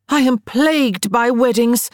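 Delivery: high-pitched, melodramatic proclamation; dramatic announcement. A narrator's reading. Sarah announces her woes to her younger sisters, melodramatic. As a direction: announce it as a grand grievance.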